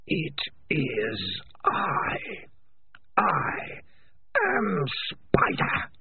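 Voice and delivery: slow unvoiced menacing spirit voice; eerie rasping Spider. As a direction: rasp it like wind in distant trees. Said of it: "like the sound of wind in distant trees".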